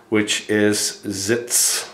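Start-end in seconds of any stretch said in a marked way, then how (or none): 1.1-1.9 Sitz said slowly with a sharp German ts ending. Shown as a demonstration. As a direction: draw out Sitz, crisp ts at the end. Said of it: "That's how we say it".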